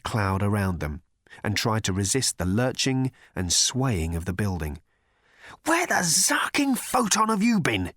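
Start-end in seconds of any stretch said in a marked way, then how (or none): 5.7-7.9 high, tense hiss of a panicking Ford; panicked hiss. A narrator's reading. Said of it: "hissed Ford, panic-stricken".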